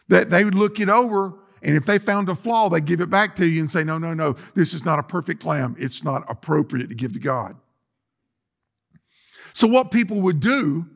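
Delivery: usual speaking voice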